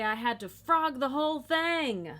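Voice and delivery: a touch of put-on drama; mock knitter's complaint. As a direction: lightly play an exasperated knitter. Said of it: "if you hear someone say".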